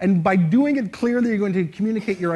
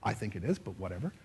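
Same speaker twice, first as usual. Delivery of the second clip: very soft, low trailing answer; doubtful shrug; 10 semitones lower, 13 dB quieter than usual. Acted. Answering whether this is cool, softly noncommittal. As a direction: mumble it off as if unsure.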